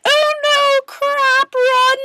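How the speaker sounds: very high, loud mock panic; hammed-up scared policeman; panicked policeman. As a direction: squeal like a terrified cop. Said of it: "the policeman would be like".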